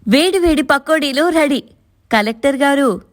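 high, bright teasing call; cheerful daughter-in-law. A narrator's reading. Daughter-in-law bringing pakodas to her sister-in-law, cheerful and teasing. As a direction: announce the snacks playfully.

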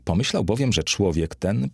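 plain reading voice

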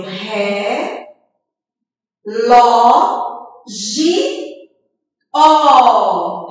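"ré, lo, gi, ó" read as four separate beats; syllable by syllable. Shown as a demonstration. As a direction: sound out relógio as "ré, lo, gi, ó". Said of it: "eu leio".

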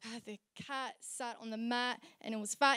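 softly imitated dull poet; mock poetry reading. Slam host imitates a poet at a reading, mock recital. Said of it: "he would stand up there and be like".

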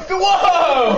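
high loud startled whoa; startled shout. Retold or imitated. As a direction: yelp it in surprise. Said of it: "She's like".

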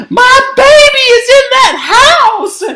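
piercing, loud motherly shriek; shrill it out at high pitch; shrill shout. A narrator's reading. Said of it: "she shrilled".

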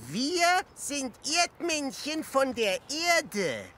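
slow and clear. Pretend. Speaking slowly to aliens.

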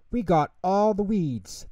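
high, cheering little voices; mild excited shout. A narrator's reading. Otters report back to Bear, high excited mild shout. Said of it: "they shouted".

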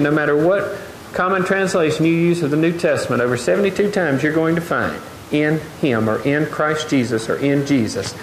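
ordinary talk, nothing marked

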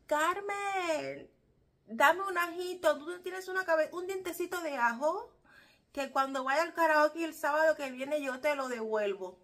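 high, loud call across to Carmen; imitating a neighbor calling out to the next house; neighbor shouting. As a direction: ask for garlic at full voice. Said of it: "qué bueno que lo gritas así".

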